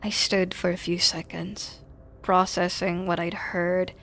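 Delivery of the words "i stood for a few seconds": ordinary talk, nothing marked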